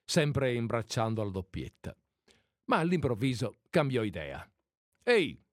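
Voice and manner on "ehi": a loud, high booming call from the window; booming shout. A narrator's reading. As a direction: bellow it out to stop them. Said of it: "gridò con la sua voce rimbombante". (grido rimbombante)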